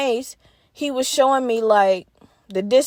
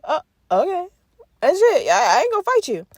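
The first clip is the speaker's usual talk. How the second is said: wary resigned; 7 semitones higher than usual. Retold or imitated. Her own wary quote in the story.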